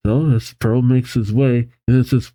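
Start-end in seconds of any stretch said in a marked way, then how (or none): none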